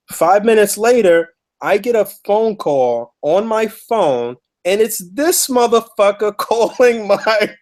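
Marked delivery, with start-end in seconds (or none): 4.6-7.5 high, slow disbelief with a laugh; slow high amazed telling; incredulous. Real, not acted. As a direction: let the shock of it land, laughing. Stunned retelling of surprise call.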